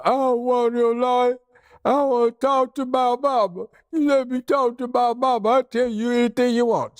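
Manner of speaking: put-on high, tearful pleading like a scared kid; crying for mama. Suspect begs the cops for his mama, high and tearful. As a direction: whimper and beg like a frightened boy. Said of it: "And he's crying".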